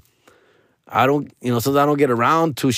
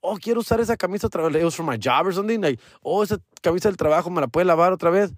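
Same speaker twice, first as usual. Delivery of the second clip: quick, higher, lightly imitated request; breezy mock-polite ask; asking a favour; 6 semitones higher, faster than usual. Speaker asking the nanny to rewash his work shirt, lightly imitated.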